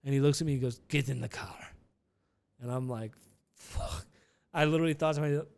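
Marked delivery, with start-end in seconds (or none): 0.9-1.6 imitated Russian accent. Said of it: "He's like a Russian guy". 3.5-4.1 mock-scared whispered curse; voiceless dread. Dreading aside on seeing driver.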